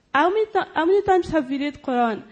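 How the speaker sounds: ordinary talk, nothing marked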